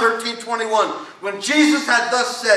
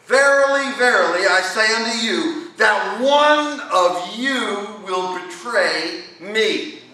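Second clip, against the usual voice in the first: each verily hit hard, the rest slow and heavy; emphatic verily; slower than usual. Acted. Preacher stresses Jesus' repeated "Verily" to the congregation, weighty and slow. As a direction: lean on both "Verily" and draw "that one of you will betray me" out slowly. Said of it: "to show you something is emphatic".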